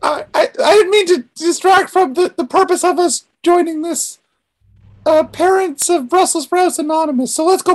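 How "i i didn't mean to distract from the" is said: ordinary delivery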